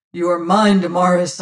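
loud, fervent declaration; man claiming his love aloud; passionate cry. Acted. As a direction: proclaim it to the pursuers. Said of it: "I cried".

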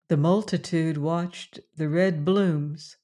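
ordinary delivery

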